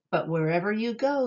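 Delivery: ordinary delivery, nothing marked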